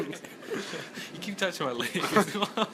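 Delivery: slow, laughing through it. Real, not acted. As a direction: giggle out the words slowly. Laughing, slowed.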